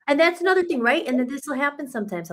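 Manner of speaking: normal talking voice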